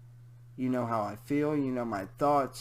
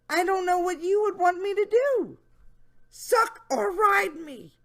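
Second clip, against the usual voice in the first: high, louder creepy question; high mock leer; Jeff Stacy voice; 7 dB louder than usual. Reading the predator's question, high mocking voice. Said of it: "my Jeff Stacy voice".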